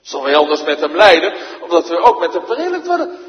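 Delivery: loud, high, ringing pulpit declamation; full-force preaching. Acted. Preacher thunders the Bible text to his congregation, full force. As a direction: proclaim it to the whole church.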